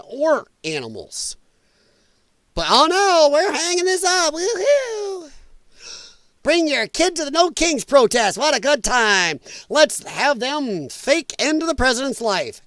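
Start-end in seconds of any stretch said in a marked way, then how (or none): 2.7-12.6 high, giddy mock cheer; sarcastic sing-song parent voice; mocking leftie parent.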